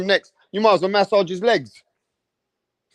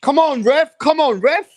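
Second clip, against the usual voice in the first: mock screaming; 8 semitones higher than usual. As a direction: shout at the ref from the crowd. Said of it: "we're screaming at him".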